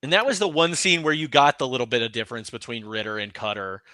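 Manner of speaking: normal talking voice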